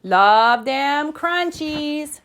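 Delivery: high, very slow relish; drawn-out gleeful kitty voice; exclaiming. Acted. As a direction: savour it like an excited kitten. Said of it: "exclaimed the kitty boy".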